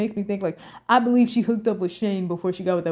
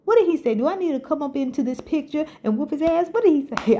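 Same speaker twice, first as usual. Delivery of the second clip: high, urgent, repeated demands; mock outrage ready to fight for her; hyped disbelief; 7 semitones higher than usual. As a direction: pester them to spill the news. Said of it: "I was just like that. I was hyped".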